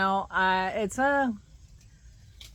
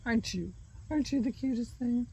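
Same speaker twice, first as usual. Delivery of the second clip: put-on high cooing baby talk to a dog; sickly sweet; 6 semitones higher than usual. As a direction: coo it like talking to a puppy.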